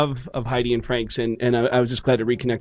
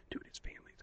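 barely audible whisper, all air; whispered gossip; 27 dB quieter, much faster than usual. Retold or imitated. Comedian gossiping about him across the club, furtive whisper. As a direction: whisper it behind someone's back.

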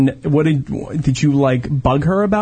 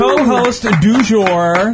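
slow announcer; 7 semitones higher, much slower than usual. Acted. Announcing the guest.

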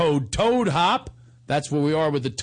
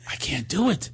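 usual speaking voice

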